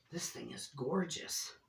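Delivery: hushed, awed whisper. Real, not acted. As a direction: breathe it out in awe. Awed whisper over gift.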